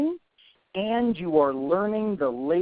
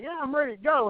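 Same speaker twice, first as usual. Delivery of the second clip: higher-pitched, lightly put-on Southern accent; 8 semitones higher, faster than usual. Applicant telling employer they're ready, higher mild Southern accent. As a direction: pitch it up with a touch of Southern twang. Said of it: "using that weird Southern accent".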